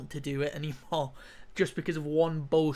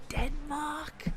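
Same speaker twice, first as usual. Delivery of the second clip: whispered; 8 semitones higher, 7 dB quieter than usual. Real, not acted. Gamer whispering the venue as an unsure aside. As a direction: whisper the place name, higher.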